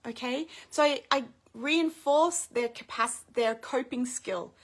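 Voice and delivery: ordinary talk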